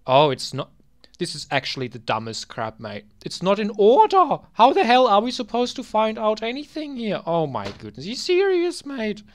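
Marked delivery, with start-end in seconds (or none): 3.2-9.2 loud, high frustrated rant; fed-up shouting at messy records; exasperated outburst. Real, not acted. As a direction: yell at the screen in disbelief. Ranting at the jumbled records.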